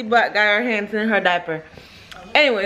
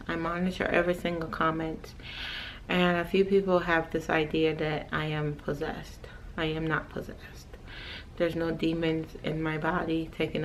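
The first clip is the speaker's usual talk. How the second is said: much softer, low, earnest talk to the viewers; quiet serious talk; 5 semitones lower, 10 dB quieter than usual. Real, not acted. Vlogger softly explains to viewers she is not possessed. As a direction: speak softly and seriously to camera.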